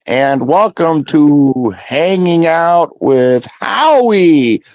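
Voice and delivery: high, drawn-out show-host greeting; slow, high impression of a host opening the show; Howie impersonation. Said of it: "I did my, my Howie impersonation".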